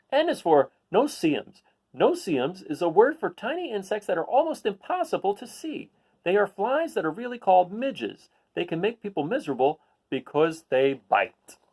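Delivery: bright, clear picture-book read-aloud; reading to children. Acted. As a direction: read it out to a class of kids.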